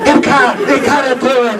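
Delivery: high, pleading mock nagging; pleading producers. Producers pestering him to take the part, high mock pleading. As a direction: beg him over and over in a squeaky voice. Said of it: "lift the lid of the toilet".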